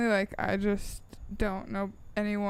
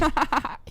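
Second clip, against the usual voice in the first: put-on kid giggling; 6 semitones higher than usual. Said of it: "impression of a child's laughter".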